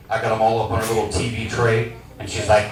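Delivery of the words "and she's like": usual speaking voice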